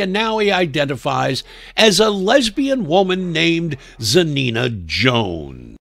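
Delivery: ordinary talk, nothing marked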